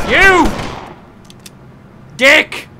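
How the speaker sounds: sudden high angry yell; panicking gamer. Real, not acted. Gamer killed again.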